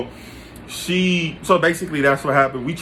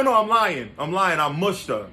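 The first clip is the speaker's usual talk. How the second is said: ordinary talk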